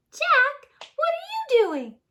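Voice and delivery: very high, loud princess call; Cinderella calling. Acted. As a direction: shout down from the castle. Cinderella calls back.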